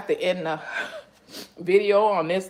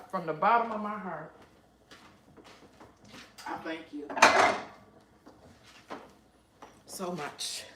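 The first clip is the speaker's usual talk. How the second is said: much softer, from the heart; heartfelt thanks; 6 dB quieter than usual. Real, not acted. Thanking subscribers from the heart, much softer. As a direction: murmur it, deeply moved.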